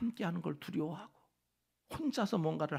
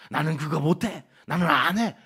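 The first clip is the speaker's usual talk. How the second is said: stubborn refusal; 12 dB louder than usual. Retold or imitated. Imitating stubborn people.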